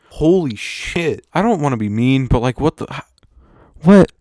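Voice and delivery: loud, rising incredulous exclamations; disbelief. Real, not acted. Vlogger reacting to a creepy movie character, loud disbelief. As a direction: blurt it out, unable to believe it.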